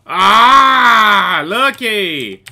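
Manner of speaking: loud drawn-out cry of triumph; excited yell. Real, not acted. Player gets lucky in the game, loud excited yell. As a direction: burst out loudly at a lucky win.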